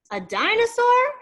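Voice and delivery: high, loud excited guess; shouted question; child shouting a guess. Acted. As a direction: blurt it out like an eager kid. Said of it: "shouts, shouts Elijah".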